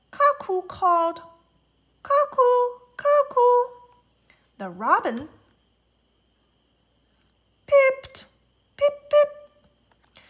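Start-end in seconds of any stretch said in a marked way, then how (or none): 2.0-3.7 high sing-song cuckoo; high bird call. Acted. 7.7-10.2 high, loud chirpy pip; high bird peep; robin peep. Acted. Robin call repeated.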